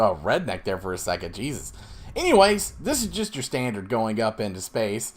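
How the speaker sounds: ordinary delivery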